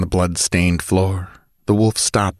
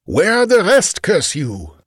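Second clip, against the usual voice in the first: raised, angry demand; 12 semitones higher than usual. Acted. Chief to dying bandit, angry demand. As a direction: force an answer out of him.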